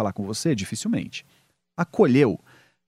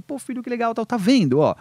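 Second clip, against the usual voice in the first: acted high-pitched congratulation; delighted parent praise; 9 semitones higher, 4 dB louder than usual. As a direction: cheer the child's success, pitched up. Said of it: "diante do sucesso".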